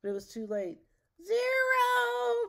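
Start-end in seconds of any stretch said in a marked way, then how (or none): 1.2-2.5 very high, loud, drawn-out cry. A narrator's reading. Witch completes the spell as the staff explodes, high loud cry. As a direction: wail the word at the top of the range. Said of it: "cried Matilda".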